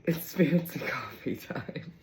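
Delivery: breathy whispered aside. Real, not acted. Whispering to viewers about fancy coffee. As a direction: whisper it like a little secret.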